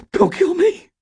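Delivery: very high, loud pleading; terrified plea. Acted. Stan pleads with the robber for his life. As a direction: plead not to be killed.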